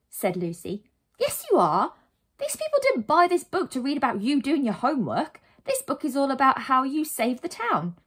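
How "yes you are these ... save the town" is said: higher, emphatic telling-off; exasperated arguing. Acted. Narrator tells the heroine to get moving, exasperated arguing. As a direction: argue back insistently.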